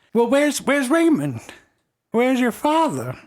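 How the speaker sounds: high, slow worried grandma; old lady. Acted. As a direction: ask after her son anxiously. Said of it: "she's like".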